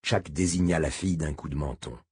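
usual speaking voice

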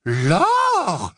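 high, swooping cry of derision; scornful guffaw. Acted. Bellatrix scoffs at the werewolf's claim, derisive. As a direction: hoot the word back in mockery. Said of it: "s'esclaffa Bellatrix".

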